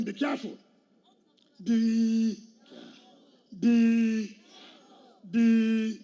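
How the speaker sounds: each "be" called out loud and drawn apart; warning shout. Retold or imitated. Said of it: "I've told them".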